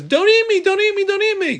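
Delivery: very high, loud squeaky food voice; a little creature squealing for its life; pleading chicken. Acted. Chicken begging not to be eaten, high squeal. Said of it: "the chicken and milk says".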